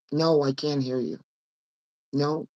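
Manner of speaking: usual speaking voice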